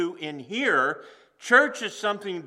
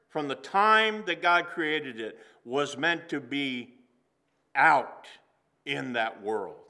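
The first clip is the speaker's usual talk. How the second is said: ordinary delivery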